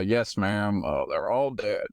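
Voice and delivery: low, slowing put-on cop voice. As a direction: play a lawman, pitched low and unhurried. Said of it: "Okay, I'll be the cop".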